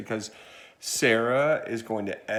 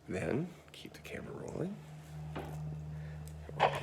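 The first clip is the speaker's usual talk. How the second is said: unvoiced stage whisper; hushed whisper to the crew; 4 semitones higher, 13 dB quieter than usual. Real, not acted. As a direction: whisper the direction. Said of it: "whispered direction".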